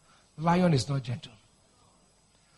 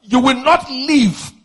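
loud, high declarations with drawn-out final words; fierce warfare prayer; 7 semitones higher, 4 dB louder than usual. Real, not acted. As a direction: shout down the enemy in prayer.

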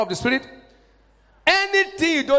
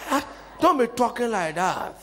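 mock civilized objection; 7 semitones lower, 5 dB quieter than usual. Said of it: "That's the way some of us do".

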